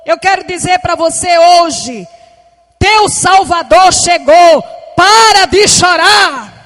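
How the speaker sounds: loud, slow, ringing proclamation; preaching at full force. Acted. Preacher declaring to the mourner, loud and triumphant. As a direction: declare it with all your strength.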